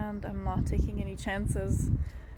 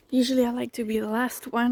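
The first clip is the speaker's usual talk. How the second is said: usual speaking voice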